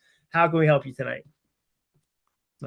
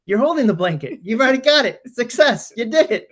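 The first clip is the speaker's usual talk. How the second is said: coaxing dad voice; 8 semitones higher, 4 dB louder than usual. Retold or imitated. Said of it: "I kept saying back to her".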